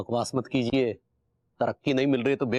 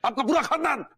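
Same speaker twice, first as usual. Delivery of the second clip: indignant outburst; 13 semitones higher than usual. Acted. Tiwari snaps back at the inspector, indignant and shrill. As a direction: bristle at being called a thief. Angry retort at policeman.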